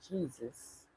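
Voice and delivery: ordinary delivery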